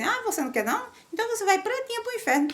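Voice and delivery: high, fast mocking jab; mocking threat. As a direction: sneer it like a smug zealot. Mocking past Christians' threats. (zombaria aguda e rápida)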